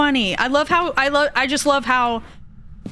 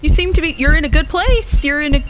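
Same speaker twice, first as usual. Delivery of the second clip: loud mock greeting; 5 semitones higher, 4 dB louder than usual. Greeting the ghost loudly.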